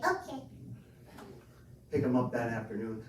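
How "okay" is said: put-on little boy. Son answers dad.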